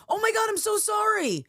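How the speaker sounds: high, flustered "so sorry"; startled apology. Retold or imitated. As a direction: gush the apology up high, caught off guard. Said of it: "and I went".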